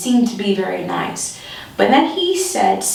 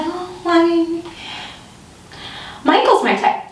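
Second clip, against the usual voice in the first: high nervous hedging; awkward deflection; 8 semitones higher than usual. Retold or imitated. Awkward deflecting of his flirt.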